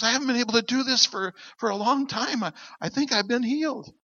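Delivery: awed niece. Retold or imitated. Fighter niece telling relatives she is healed, high awed voice.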